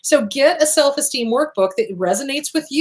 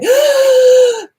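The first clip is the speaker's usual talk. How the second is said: loud, high, hammed-up gasp of panic; panicked gasp; 8 dB louder than usual. Mocking lying awake until eight o'clock, loud mock gasp. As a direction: gasp like a worrier staring at the ceiling. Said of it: "stare at the ceiling ... going".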